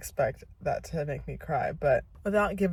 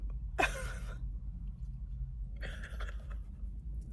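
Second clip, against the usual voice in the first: teary laugh; 13 dB quieter than usual. Real, not acted. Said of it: "show proof that I'm crying".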